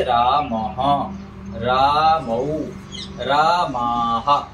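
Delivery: रामः, रामौ, रामाः very slowly, vowels drawn out; careful pure pronunciation. Shown as a demonstration. Teacher models Sanskrit forms for students, रामः, रामौ, रामाः slow and careful. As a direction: draw out each syllable of रामः, रामौ, रामाः, sounding the final h. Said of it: "अच्छे से शुद्ध उच्चारण करेंगे".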